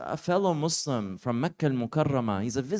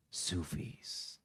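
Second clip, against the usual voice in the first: hushed ominous; 9 semitones lower, 17 dB quieter than usual. Retold or imitated. Dramatic reveal of dreaded word.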